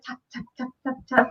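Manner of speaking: soft, light rain taps; imitated rain tapping. Lulled by rain at bedtime, imitated tapping. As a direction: tap out the rain quietly with the voice. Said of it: "the tapping sound".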